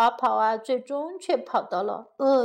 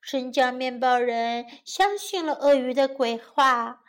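ordinary delivery, nothing marked

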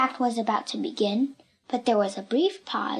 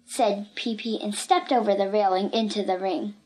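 ordinary delivery

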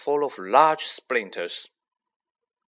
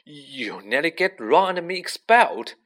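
higher, louder angry accusation; fierce; 6 semitones higher, 5 dB louder than usual. A narrator's reading. Boy to the elf who nearly got him expelled, fierce. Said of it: "he said fiercely".

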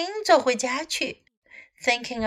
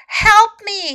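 high, loud narrated cry for help; storybook scream of fright, not full force; frightened scream; 8 semitones higher, 7 dB louder than usual. A narrator's reading. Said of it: "he screamed".

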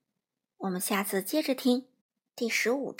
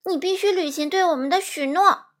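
high, loud, insistent voice; loud demand; 6 semitones higher, 7 dB louder than usual. A narrator's reading. Dorothy holding the wizard to his promise, loudly. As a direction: insist at raised volume. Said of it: "多罗西大声说".

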